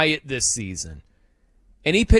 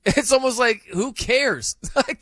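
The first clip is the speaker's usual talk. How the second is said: ordinary talk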